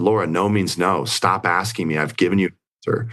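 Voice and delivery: ordinary delivery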